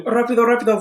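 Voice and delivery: rapido flung out high and loud like a racing car; a playful vroom on rapido; speeding car. Acted. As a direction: make rapido zoom past.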